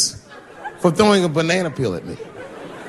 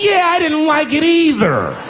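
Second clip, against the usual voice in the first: much higher, slow, timid agreement; nerdy agreeing guy; 12 semitones higher, 3 dB louder, slower than usual. Retold or imitated. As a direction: chime in meekly.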